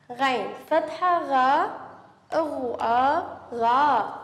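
غين فتحة غا spelled slowly, letter by letter; slow spelling drill. Shown as a demonstration. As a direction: sound out أغو آ غا with a long drawn vowel. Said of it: "سنعيد الآن". (تهجئة بطيئة ممدودة)

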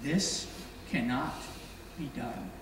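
fading to almost nothing, low and quiet; hopeless crowd. Retold or imitated. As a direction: let the words die away in despair. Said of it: "the group, saying".

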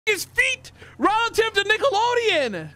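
high, incredulous outburst; exasperated protest. Real, not acted. Player challenging partner's silly guess, exasperated. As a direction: voice climbing in indignant disbelief.

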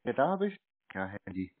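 ordinary delivery

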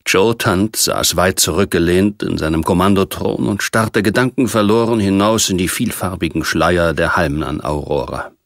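ordinary delivery, nothing marked

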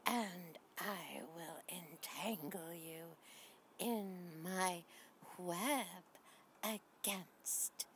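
slow, soft creepy spider whisper; spooky whisper. Acted. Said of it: "She's saying".

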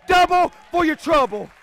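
very high, loud holler; full-force preaching. Real, not acted. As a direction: defy the devil at the top of your voice.

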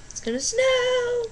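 high, slow squeal on the words; excited squeal. Real, not acted. Vlogger announcing snow to viewers, high excited squeal. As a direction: stretch it up into a giddy squeal. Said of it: "I'm just so excited".

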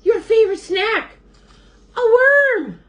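high, loud, thrilled reveal; excited announcement. A narrator's reading. Fox shows Bird a worm, high excited cry. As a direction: build up to a big loud finish.

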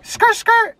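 high squealing skirt skirt like a car peeling off; tire screech sound. Pretend. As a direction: mouth a screeching getaway. Mimics tires screeching off.

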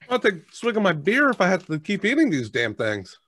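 usual speaking voice

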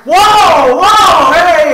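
loud, high whoa of surprise; surprised shout. Real, not acted. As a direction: yell out in shock. Big surprise during match.

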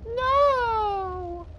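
high woman's voice. Acted. Woman character cries out.

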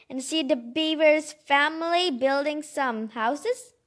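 plain reading voice

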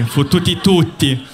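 normal talking voice

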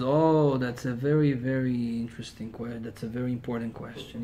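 soft, low, patient reply; calm rabbi. Retold or imitated. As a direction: answer the rude man gently. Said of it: "Hillel is very calm".

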